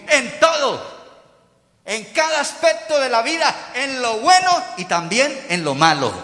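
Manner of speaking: high, loud pulpit delivery; emphatic preaching. Real, not acted. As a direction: drive each phrase home to the congregation. Preaches at full force.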